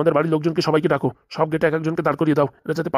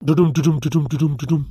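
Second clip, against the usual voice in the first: mock drum roll; 3 semitones lower than usual. Police arrival sound effect voiced.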